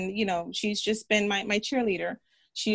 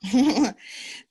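a short real laugh, a bit louder; 2 semitones higher, 5 dB louder than usual. Real, not acted. Guest laughing along with the moved host, real laugh. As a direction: laugh warmly at the host's emotion.

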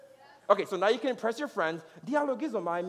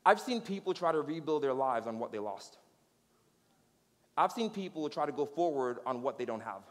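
lower, softer, measured delivery; solemn preaching; 4 semitones lower, 4 dB quieter than usual. Real, not acted. Preacher reflecting on people building on lack, solemn and low. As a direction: let each observation sink in gravely.

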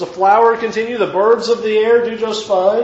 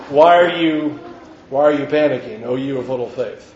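calm gentle rebuke; 7 semitones lower than usual. Retold or imitated. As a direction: calmly chide frightened friends. Jesus calmly answering panicking disciples.

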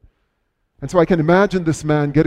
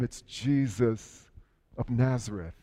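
hushed answer; 4 semitones lower, 11 dB quieter than usual. Retold or imitated. Bystander quietly answering the blind man.

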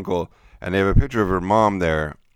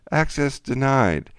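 polite computer voice; 6 semitones higher, slower than usual. Retold or imitated. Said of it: "a nice woman would say".

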